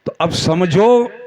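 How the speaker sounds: rhetorical build to a ringing finish; fervent preaching. Real, not acted. Preacher contrasts follower and Prophet for the congregation, rising oratory. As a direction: pose the contrast, then drive it home to the crowd.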